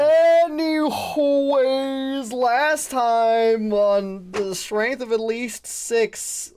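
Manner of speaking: high, loud, slow hype recap; hyped announcer. Acted. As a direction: boom out the recap.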